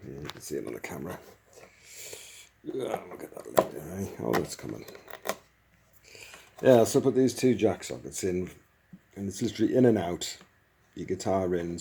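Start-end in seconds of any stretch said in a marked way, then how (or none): none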